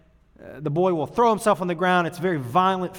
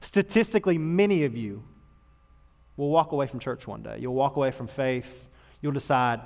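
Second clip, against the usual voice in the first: ordinary delivery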